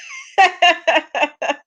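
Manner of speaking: high-pitched fit of laughter; real laughter. Real, not acted. Host amused by messy chat comments, high laughter.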